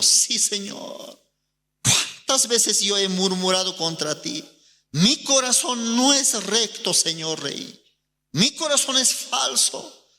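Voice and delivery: put-on a child begging the king for mercy; broken confession.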